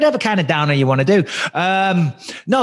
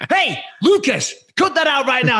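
stern telling off; 8 semitones higher than usual. Retold or imitated. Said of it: "I'm like".

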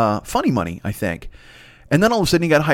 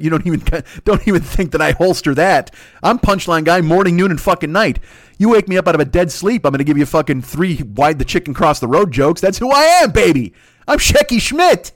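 mock-boastful rant; 2 semitones higher than usual. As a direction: boast like an old-school club comic, peaking big. Mock-boastful punchline guy rant.

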